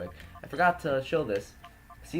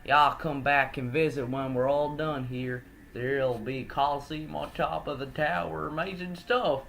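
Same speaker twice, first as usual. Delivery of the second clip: put-on country accent; 3 semitones higher than usual. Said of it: "given him a country accent".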